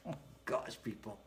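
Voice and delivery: muttered under the breath; whispered aside. Real, not acted. Exasperated streamer to himself, whispered. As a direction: whisper it to yourself.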